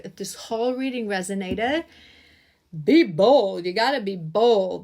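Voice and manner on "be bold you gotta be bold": loud, forceful push on be bold; rally the listener like a coach; firm loud command to be bold; emphatic urging. Real, not acted. Urging viewers to take bold action.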